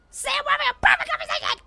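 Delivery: sudden high, loud strained yell for fun; whisper screaming. Pretend. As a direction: scream at someone for butting in, playfully overblown. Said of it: "sometimes I just like yelling".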